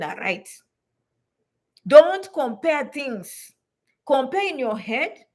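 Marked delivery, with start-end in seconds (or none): 1.9-3.5 very slow, DONT stressed; slow firm command. Real, not acted. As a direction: lay down the rule slowly. Emphatic rule.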